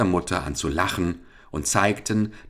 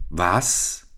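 ordinary delivery

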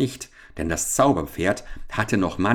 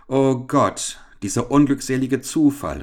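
raised, exclaimed mock consternation; feigned dismay; 6 semitones higher, 4 dB louder than usual. Captain breaking bad news to Richard, as if dismayed. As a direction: cry out as if aghast. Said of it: "wie vor Bestürzung".

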